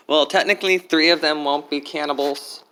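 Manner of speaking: normal talking voice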